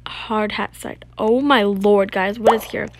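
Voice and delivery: ordinary delivery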